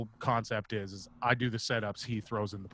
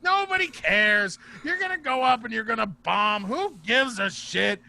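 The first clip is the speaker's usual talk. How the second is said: imitated dismissive shouting, stretched out; Bob; 13 semitones higher, 8 dB louder than usual. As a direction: bellow each phrase like the loudest comic in the room. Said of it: "Bob's really loud".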